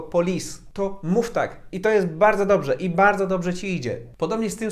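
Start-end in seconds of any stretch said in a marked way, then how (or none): 0.0-0.6 "police" said hard and Polish, full O; hard homely police. Shown as a demonstration. Telling learners their Polish "police" is fine. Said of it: "ty sobie mówisz twardo, swojsko".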